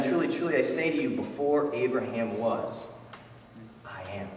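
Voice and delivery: low and soft, sinking further to the end; hushed solemn declaration. Acted. Jesus declaring himself to the crowd, hushed and solemn.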